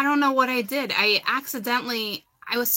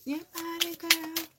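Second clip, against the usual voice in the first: higher, hushed mock come-on; soft mock seductive catcall; sultry fella; 8 semitones higher, 5 dB quieter than usual. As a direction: purr it like a flirting guy, teasing. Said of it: "Make the fellas go".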